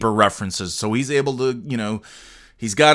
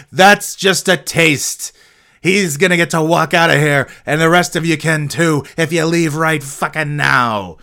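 menacing threat; 5 semitones higher, 5 dB louder than usual. Retold or imitated. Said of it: "He didn't say it quite like that".

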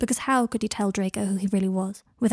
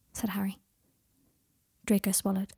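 usual speaking voice

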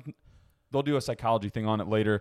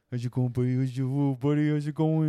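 mild pet-talk voice, barely changed; a hint of talking to a dog; same friendly dog voice, understated. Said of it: "the dog voice".

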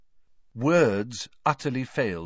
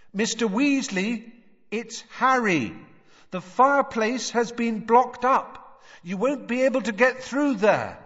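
calling out; 12 semitones higher, 4 dB louder than usual. A narrator's reading. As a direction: stretch the words out so they carry. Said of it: "called through the boards".

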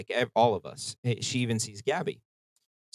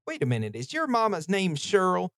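high-pitched imitated country accent; 8 semitones higher than usual. Nurse recognizing her patient's family, imitated country accent. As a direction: mimic a country nurse's sudden question. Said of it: "in the most country accent".

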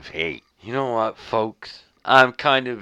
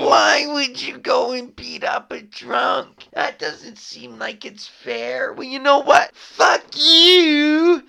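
high, loud, crying mock whine; mocking a whining critic, voice cracking high; whiny scold; 7 dB louder, slower than usual. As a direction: whine at the hater like a wounded critic. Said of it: "You could sit there and say to me".

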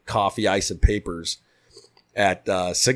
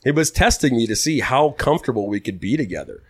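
ordinary delivery

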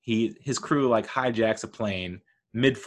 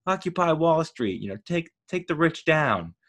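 mock fired-up rallying; 8 semitones higher than usual. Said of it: "You're like".

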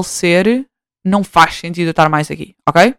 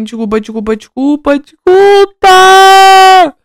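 súplica a chorar; 11 semitones higher, 8 dB louder, much slower than usual. Retold or imitated. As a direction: do normal a um lamento muito agudo e alto, arrastado no fim. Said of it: "eu no final só pedia para a mãe". (a filha implora perdão à mãe, a acabar num choro agudo e alto)

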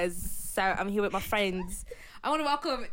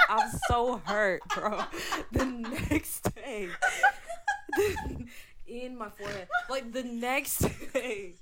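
laughing; 5 dB louder, slower than usual. Real, not acted. Laughing through story.

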